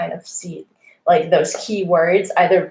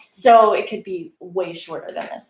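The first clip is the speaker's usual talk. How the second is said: ordinary delivery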